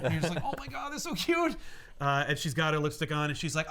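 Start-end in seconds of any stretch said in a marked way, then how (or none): 0.4-1.5 high-pitched gush of delight; mock gushing. Fan gushing over girl in lipstick, mock squeal. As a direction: squeal it like cooing over something adorable.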